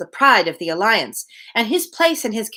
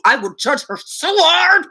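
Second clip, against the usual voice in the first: mock vow; 8 semitones higher than usual. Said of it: "She met her at first and decided".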